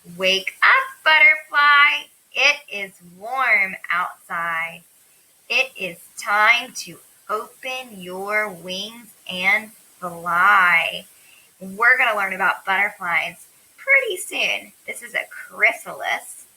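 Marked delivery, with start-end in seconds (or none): 0.1-11.1 slow storybook read-aloud, loud on the opening call; unhurried, measured picture-book reading; reading to children. Acted. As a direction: read it slowly and deliberately to little kids.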